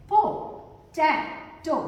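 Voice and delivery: "पोटैटो" very slow, as पो, टै, टो; syllable by syllable. Shown as a demonstration. Teacher introduces next word to learners, "पोटैटो" slowly split. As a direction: sound out "पोटैटो" in separate beats.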